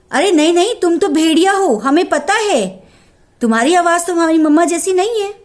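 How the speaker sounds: high, loud shouted refusal; kids shouting. Acted. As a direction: kids yell at the wolf through the door. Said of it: "अंदर से क्या चिल्लाए". (अंदर से चिल्लाते बच्चे)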